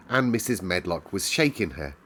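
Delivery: plain reading voice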